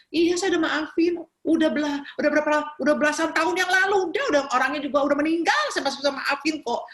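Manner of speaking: high, hurried retold brush-off; exasperated reassurance. Preacher assuring the caller of forgiveness years later, acted exasperation.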